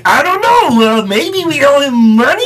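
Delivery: Pain and Panic voice. Retold or imitated. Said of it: "Did one of them sound like".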